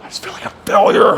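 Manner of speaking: whispered, fast and defeated; whispered confession. Real, not acted. Vlogger confessing he feels a failure, whispered. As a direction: breathe it out under your voice.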